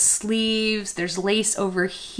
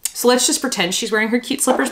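normal talking voice

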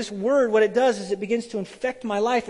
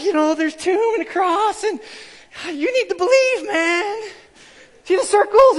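much higher, louder nervous rush with a nervous laugh; scared nervous pitch; 12 semitones higher, 10 dB louder than usual. Retold or imitated. Young [name] pitching faith to a stranger, high scared squeak. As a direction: squeak it out like a terrified beginner. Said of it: "I got so freaked out and scared".

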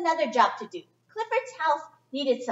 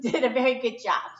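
usual speaking voice

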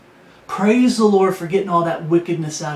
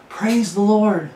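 "Praise the Lord" raised high and drawn out slowly; joyful praise; 4 semitones higher, slower than usual. Real, not acted. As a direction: lift "Praise the Lord" up high, slow and glad. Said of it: "That's the attitude of someone who's saved".

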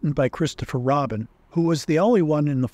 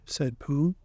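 ordinary delivery

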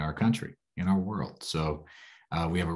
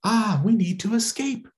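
high, louder startled squeak; startled child; 5 dB louder than usual. Acted. Little girl startled by a voice in the dark, high and loud. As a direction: jump at a sudden stranger.

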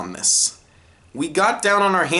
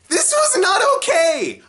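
outraged shout; 13 semitones higher than usual. Real, not acted. Outraged at ashes during lunch.

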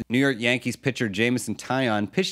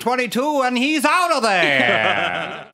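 put-on higher, brassy old radio play-by-play; old-timey radio announcer; 9 semitones higher, 4 dB louder than usual. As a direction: call it like a vintage ballgame broadcaster. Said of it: "old-timey radio voice".

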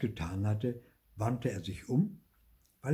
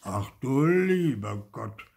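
slow, drawn-out sigh of dismay; sighing lament; 4 dB louder than usual. A narrator's reading. Saddened woodcarver despairing of his figure, sighing. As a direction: sigh the words out slowly. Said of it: "seufzte er".

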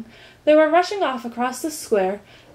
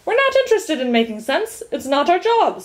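higher, louder mild scolding; 7 semitones higher, 4 dB louder than usual. A narrator's reading. First minister corrects Milo, scolding. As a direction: tell him off firmly. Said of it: "scolded the first".

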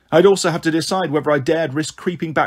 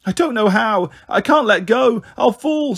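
put-on frightened child; 6 semitones higher than usual. A narrator's reading. Frightened girl stuck up the tree.

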